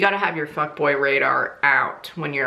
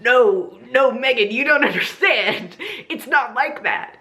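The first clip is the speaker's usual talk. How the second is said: Randy's excuse; 8 semitones higher than usual. Retold or imitated. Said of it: "And he was like".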